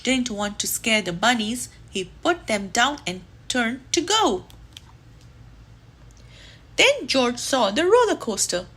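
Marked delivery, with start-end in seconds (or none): none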